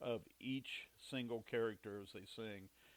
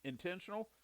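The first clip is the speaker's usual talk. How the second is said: higher incredulous rising question; mock disbelief; 8 semitones higher than usual. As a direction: echo his word in disbelief. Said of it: "And she goes".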